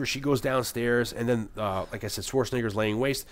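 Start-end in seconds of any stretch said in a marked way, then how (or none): none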